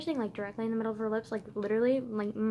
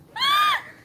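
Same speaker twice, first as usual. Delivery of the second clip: gasp; 7 dB louder than usual. Real, not acted. Gasp after shout.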